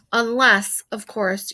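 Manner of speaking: ordinary delivery, nothing marked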